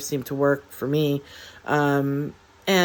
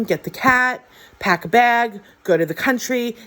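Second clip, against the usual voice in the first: higher, urgent mock yell of orders; mild mock yell, pitched up with urgency; retold frantic screaming; 6 semitones higher than usual. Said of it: "He was screaming".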